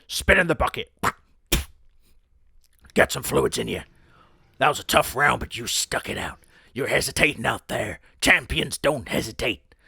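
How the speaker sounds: pep up your fighter between rounds; gruff boxing coach. Pretend. Boxing coach in the corner to his fighter, gruff and loud. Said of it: "like a boxing coach in the corner".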